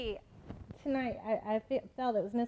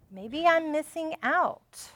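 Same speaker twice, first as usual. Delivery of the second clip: slow, higher and louder realization; dawning desire; 4 semitones higher, 6 dB louder, slower than usual. Acted. Preacher voices Eve tempted by desire, slow and rising in force. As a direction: stretch it out as temptation takes hold. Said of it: "and even of desire".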